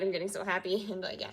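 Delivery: normal talking voice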